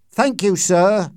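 high, loud, slow reply; drawn-out note of triumph; triumphant. A narrator's reading. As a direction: call it back with triumph. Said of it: "There was triumph in his voice".

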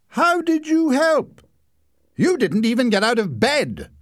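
high, loud incredulous jab; mock his heroics; scoffing. A narrator's reading. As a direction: brother deflating a boastful brother. Leslie mocking Larry.

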